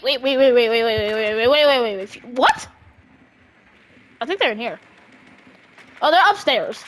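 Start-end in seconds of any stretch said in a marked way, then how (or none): none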